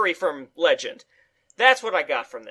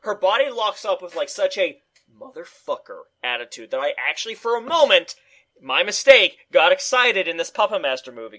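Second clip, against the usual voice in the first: ordinary talk, nothing marked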